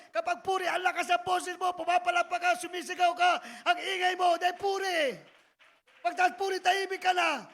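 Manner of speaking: building loud preaching. Real, not acted. Preacher describing noisy praise to the church, loud.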